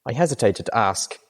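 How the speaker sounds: usual speaking voice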